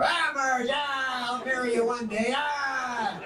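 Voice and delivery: high, drawn-out, hammed-up holler; mock trucker yell, high and long; trucker wooing waitress. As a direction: holler sweet talk at the waitress. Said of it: "talk to them like no other waitresses".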